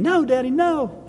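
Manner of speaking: high-pitched mock cry of protest; imitated child's scream. Child protesting to father holding her for a shot, imitated scream. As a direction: imitate a panicked little girl, pitched well up. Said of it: "She started screaming".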